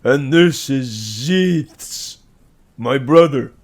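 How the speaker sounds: slow, very loud oaf voice; dim brute. A narrator's reading. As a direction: boom it proudly. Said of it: "Cal repeated".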